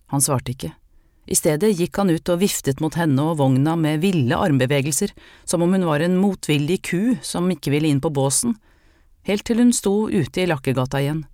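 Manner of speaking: usual speaking voice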